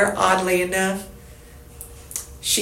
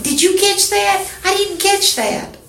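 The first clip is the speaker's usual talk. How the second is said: high, slightly louder mock voice of the woman; imitating her; 12 semitones higher, 3 dB louder than usual. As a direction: play her squeaky puzzlement, hammed-up. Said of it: "she's like".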